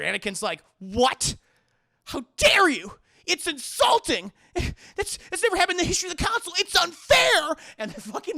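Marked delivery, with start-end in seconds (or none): 0.8-7.6 high, loud indignant yelling; outraged shout. Retold or imitated. Anakin yelling at the council in high outrage. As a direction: scream the insult like a spoiled kid.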